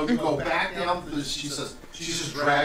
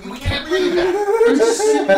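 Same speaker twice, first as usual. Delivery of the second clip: laughing plea; 9 dB louder, much slower than usual. Acted. Pleading while laughing.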